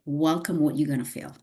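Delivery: normal talking voice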